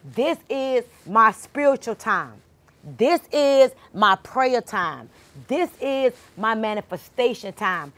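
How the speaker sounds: preacher leading the congregation in a slow affirmation; call and response. Shown as a demonstration. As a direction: lead the room word by word. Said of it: "y'all, say it with me".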